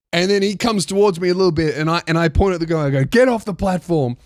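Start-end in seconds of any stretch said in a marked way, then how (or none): none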